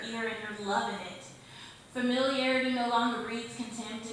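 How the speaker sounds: punchy, rhythmic stage recitation, line openings hit hard; spoken-word poem. Acted. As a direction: perform the verse for an open-mic crowd. Recites her insanity poem.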